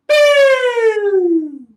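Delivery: long, loud, high sung boop; sung high note for the kids; high held boop. Shown as a demonstration. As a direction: slide way up and hold it. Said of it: "make that sound just like Mister V".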